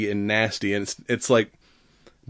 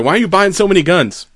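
mock nosy asker; 7 semitones higher, 6 dB louder, faster than usual. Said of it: "Anyone's like".

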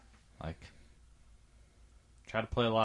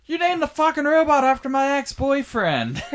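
high, loud, put-on shriek of disbelief; mock outraged daughter; 9 dB louder than usual. Retelling the movie daughter's complaint about the robot, in a high mock shriek. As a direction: squeal it like the betrayed daughter.

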